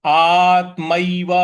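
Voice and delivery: आत्मैव slow and loud, त lightly pressed; stress on त. Shown as a demonstration. Teacher demonstrating the stressed word, slow and loud. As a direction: lean on the त in आत्मैव. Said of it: "यहाँ हल्का सा बल लगाएंगे".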